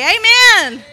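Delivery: very loud, high 'Amen'; jumping amen shout. Acted. Preacher showing how listeners jump and respond, shouted. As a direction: shout it like a roused congregation. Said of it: "You jump and say".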